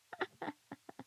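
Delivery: high little laugh; giggle. Real, not acted. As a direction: burst into a giggle. Laughs at chat name.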